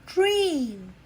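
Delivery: slow, lilting title announcement; storybook title. A narrator's reading. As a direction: announce the book title warmly. Title read aloud.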